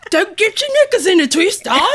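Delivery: high, loud mock-English squawk; annoying English accent. Friends teasing about England trip, imitated annoying English accent. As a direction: squeal it in a badly faked English accent. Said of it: "got an annoyingly English accent back".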